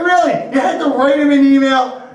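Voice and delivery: high-pitched, incredulous needling; mock disbelief. Teasing the boss about writing an email, mock incredulous.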